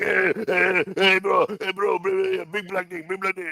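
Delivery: mock yell of a guy talking over you; mock screaming. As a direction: imitate the Ripper screaming over everyone. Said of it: "just screaming over and over again".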